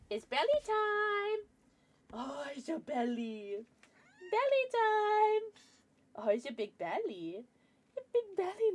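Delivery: cutesy sing-song voice swinging up high. Real, not acted. Streamer fussing over the cat's big belly, cooing baby talk.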